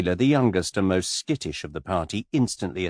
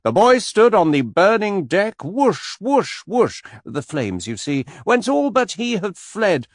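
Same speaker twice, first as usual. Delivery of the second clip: much higher, louder, acted-out recitation; hammed-up recital with action; 12 semitones higher, 6 dB louder, slower than usual. Shown as a demonstration. Anthony showing off to Miss Taylor, hammed-up recital. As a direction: ham it up with whooshing flames. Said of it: "I can do that bit with action".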